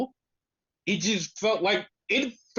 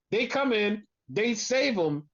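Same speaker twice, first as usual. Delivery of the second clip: ordinary delivery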